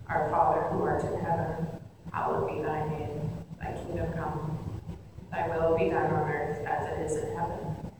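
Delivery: soft, hushed prayer reading; quiet morning prayer; prayer recitation. Acted. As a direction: recite it reverently under your breath.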